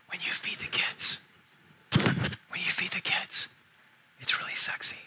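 slow, intimate stage whisper; imitated whisper. Husband imitating his wife at the kitchen table, whispering. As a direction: lean in and whisper it, voiceless. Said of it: "when she's whispering to me".

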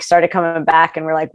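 usual speaking voice

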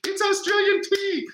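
put-on very high squealing kid voice; imitating thrilled children cheering; excited kids. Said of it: "Kid's like".

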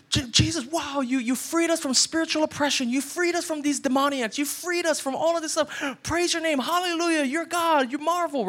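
high, excited, gushing praise; mock celebration. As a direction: gush with thanks like a grateful crowd. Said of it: "you think these people are gonna come out and celebrate you".

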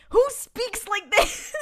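high, slow, mocking disbelief; who even talks like that, said high; exaggerated incredulous question. Real, not acted. Vlogger mocking the dialogue.

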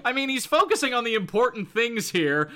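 ordinary delivery

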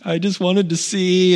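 high-pitched imitation of a child's excuse; young son. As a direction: play a little boy explaining himself, high. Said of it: "he's like".

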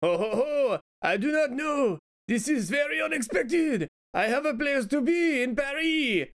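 high-pitched, hammed-up French accent; exaggerated French accent in a mock romance. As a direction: play a comic French character, pitched well up. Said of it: "I'll do a French accent".

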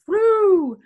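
high, loud swooping woo; whooped "woo". Shown as a demonstration. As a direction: demonstrate a bump with a whoop. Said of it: "full bump, like".

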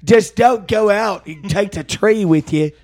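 high, loud hammed-up Cockney talk; mock Cockney. Joking about the crooner, in mock Cockney. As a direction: ham up a Cockney geezer. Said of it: "he has an English accent when he's talking".

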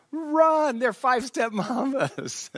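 a high, loud alarm call; panicked shout. Retold or imitated. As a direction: yell the warning, high and urgent. Warning cousins of approaching mambas.

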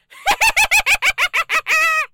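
shrieking high witch cackle. Acted. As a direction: cackle loud like a Halloween witch. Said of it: "Those are some very accurate cackles".

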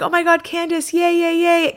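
excited cheering. Retold or imitated. Friends cheering Candace back at the group, excited high squeal. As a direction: squeal it like the whole group celebrating.

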